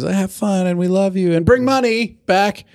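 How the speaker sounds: lightly voiced cheerful friends; cheery farewell voice. Friends voiced saying goodbye.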